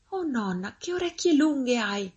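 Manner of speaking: put-on high-pitched child's wonder; high, astonished child voice; amazed little girl. A narrator's reading. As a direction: marvel like a storybook little girl. Girl marvels at disguised wolf.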